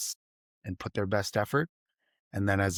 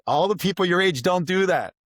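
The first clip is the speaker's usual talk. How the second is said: grateful boss; 9 semitones higher, 5 dB louder than usual. Retold or imitated. Boss thanking the young programmer, high and gushing. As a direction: gush thanks like a relieved boss. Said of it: "He said, like".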